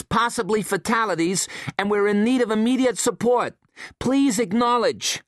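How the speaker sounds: high urgent radio call for help; Abby's distress call. A narrator's reading. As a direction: broadcast a desperate mayday, clipped and formal.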